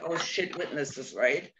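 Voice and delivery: low, hushed oh-no aside; sudden worried mutter under the breath; dismayed aside. Retold or imitated. Mother realising there are witnesses, low hushed dismay. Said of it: "And I'm like".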